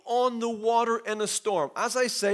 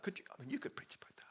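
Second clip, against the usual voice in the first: very soft whisper; whispered aside; 22 dB quieter, much faster than usual. Real, not acted. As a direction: confide it to the congregation under the breath. Confidential aside to the congregation.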